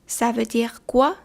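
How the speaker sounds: Ça veut dire quoi said slowly, each word apart; slow, careful repeat. Shown as a demonstration. Teacher to learners, slow model repeat. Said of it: "teacher repeats slowly for learners".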